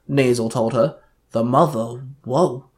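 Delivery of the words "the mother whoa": understated, stunned mutter; a quietly stunned question; muttered shock. A narrator's reading. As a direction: mutter it as if taken aback. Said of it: "Taylor muttered in shock".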